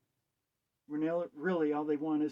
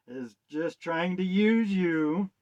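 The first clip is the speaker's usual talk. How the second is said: emphatic; 4 semitones higher, 8 dB louder, slower than usual. Real, not acted.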